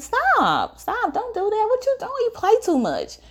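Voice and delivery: brushing him off as a joke; teen telling a friend he plays too much; playful protest. Retold or imitated. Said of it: "I'm laughing".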